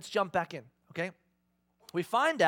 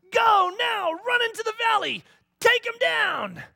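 much higher, louder shouting command; urgent shout; 10 dB louder than usual. Acted. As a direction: yell the order to charge. Deborah urging Barak to charge.

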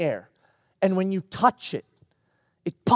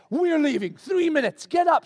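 raised-pitch wake-up orders; acted parental urgency; 7 semitones higher than usual. Father rousing daughter to leave, acted urgency. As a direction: rouse the kid in a hurry.

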